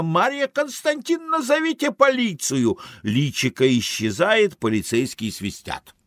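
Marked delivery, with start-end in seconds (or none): 0.0-2.8 high, frantic call for help; desperate cry. Acted. Chased Arthur calls to the cashier, desperate high cry. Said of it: "кричит отчаянно".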